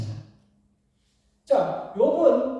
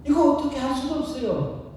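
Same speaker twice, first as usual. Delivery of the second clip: ordinary delivery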